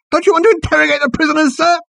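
very high, fast squeal of frustration; frustrated, high squealing underling; frantic squeal. A narrator's reading. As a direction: squeal it in a rush. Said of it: "he squealed".

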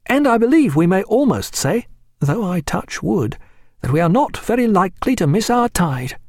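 lightly voiced keen young officer. A narrator's reading. Reed voiced.

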